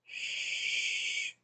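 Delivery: drawn-out hushing; long shush. Real, not acted. Player hiding from an enemy, long shush. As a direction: shush them, staying hidden.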